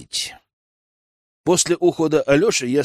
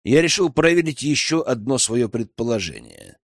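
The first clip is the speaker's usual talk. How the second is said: usual speaking voice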